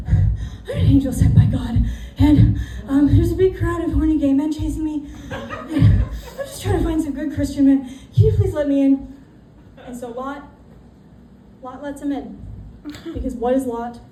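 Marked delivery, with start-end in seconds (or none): 0.1-9.1 louder, rushed plea at the door; the angel begging to be let in, loud; frantic angel. Retold or imitated. Angel begging Lot at the door, loud and urgent.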